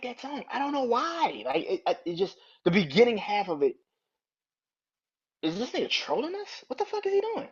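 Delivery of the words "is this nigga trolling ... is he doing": incredulous. Real, not acted. Disbelief at song.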